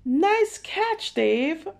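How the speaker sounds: high, loud proud cheer. Acted. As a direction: cheer on your little brother. Brother praises catch.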